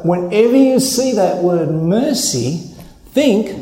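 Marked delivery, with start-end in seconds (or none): none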